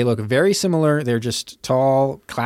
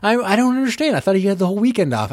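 mock-baffled, higher voice; confused; 9 semitones higher, faster than usual. Host retelling own confusion at the plot, mock-baffled and higher. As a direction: retell your confusion in a raised, puzzled tone. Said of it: "I was so confused".

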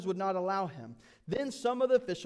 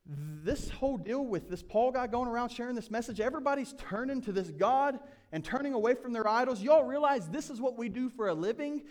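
higher and louder, an agitated tradesman; indignant rant; 6 semitones higher, 4 dB louder than usual. Acted. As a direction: stir up the crowd about lost income. Demetrius voiced stirring up workers.

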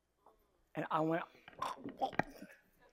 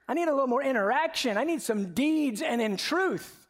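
lightly voiced wife, higher and louder, playfully complaining; mock wife; 8 semitones higher, 5 dB louder than usual. Pastor mimics wife wanting more than words, playful mock complaint. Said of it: "She'd be like".

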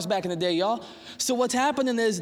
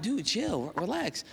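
soothing; 2 semitones higher, 5 dB quieter than usual. Retold or imitated. Calming frustrated friend, retold.

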